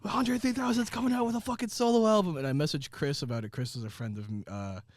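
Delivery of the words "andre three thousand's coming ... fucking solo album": excited. Retold or imitated. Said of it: "He was like".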